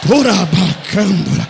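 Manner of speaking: slow, low, loud tongues; speaking in tongues. Acted. Pastor bursts into tongues mid-sermon, slow and loud.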